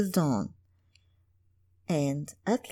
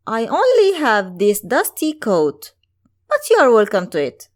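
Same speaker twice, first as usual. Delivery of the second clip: put-on louder, quick kindly young prince; 4 semitones higher, 8 dB louder than usual. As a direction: offer it freely, a generous prince.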